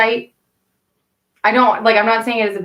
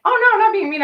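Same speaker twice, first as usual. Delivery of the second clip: mock high protest; 12 semitones higher, much faster than usual. Retorting in the shower.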